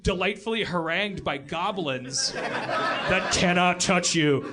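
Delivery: ordinary talk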